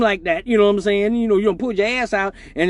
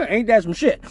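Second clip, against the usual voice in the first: usual speaking voice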